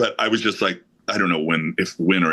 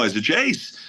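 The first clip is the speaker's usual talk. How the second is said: ordinary talk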